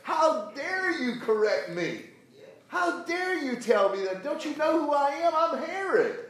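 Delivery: high, loud outraged king's voice; furious Herod. Acted. King scolding the prophet who corrected him, furious high shout. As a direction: roar it like an insulted king. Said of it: "Herod was furious".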